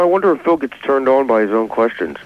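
ordinary talk

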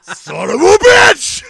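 high, very loud, drawn-out roar; mock furious yell. Raging over a TV finale, mock furious yell. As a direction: explode in hammed-up rage.

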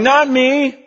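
usual speaking voice

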